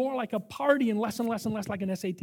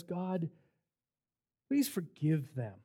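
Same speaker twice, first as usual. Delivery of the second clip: softer, slower, hushed plea; tender prayer; 2 semitones lower, 4 dB quieter, slower than usual. Retold or imitated. Jesus on the cross asking God to forgive, tenderly. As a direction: pray it gently, barely above a breath. Preacher voices Jesus praying gently.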